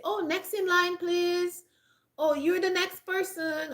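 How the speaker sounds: high, sweet customer-service call; polite address demo. Shown as a demonstration. Showing a cashier how to call the next customer, politely. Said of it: "If you wanna address them".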